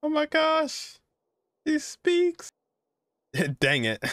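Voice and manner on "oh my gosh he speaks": high, delighted surprise; amazed squeal at a talking character; surprised. Real, not acted. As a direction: gasp it out as the silent one talks. Character suddenly talks in cutscene.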